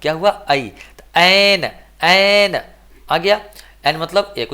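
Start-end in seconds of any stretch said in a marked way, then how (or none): 1.2-2.6 एन drawn out with a long open "ae"; model pronunciation. Shown as a demonstration. Teacher repeats it so students hear the vowel, model pronunciation. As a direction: stretch the vowel of एन, slowly. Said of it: "ए एन को एन पढ़ेंगे".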